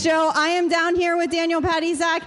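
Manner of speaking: normal talking voice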